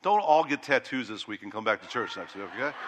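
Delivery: normal talking voice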